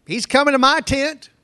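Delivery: rising alarm as he nears her tent; Rachel's alarm. Retold or imitated. Preacher voicing Rachel as Laban nears, alarmed.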